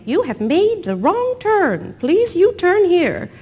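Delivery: slow, high automated navigation voice; GPS voice. Retold or imitated. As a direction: speak like a sat-nav robot reading directions. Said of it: "his G P S automated voice saying".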